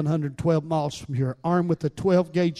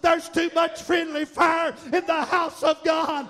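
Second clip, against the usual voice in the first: fiery preaching; 9 semitones higher than usual. Acted. Pastor whooping to the congregation, fiery preaching. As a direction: preach it at full force, building.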